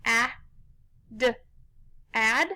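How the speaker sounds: "a, d" as separate short sounds, then blended "ad"; sounded out. Shown as a demonstration. Teacher tapping out the first syllable, sounded out. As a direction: tap out "a" and "d" with pauses, then say "ad". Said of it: "let's tap out those syllables".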